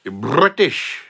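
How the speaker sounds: jokey mock voice; mock accent voice. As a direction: tease in a put-on accent.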